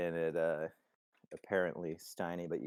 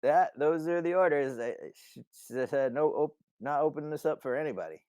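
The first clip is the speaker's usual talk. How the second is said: raised, higher voice, stumbling refusal; stubborn guard; 9 semitones higher, 7 dB louder than usual. Acted. Steiny telling Simon he has orders, stubbornly. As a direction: call down a firm refusal.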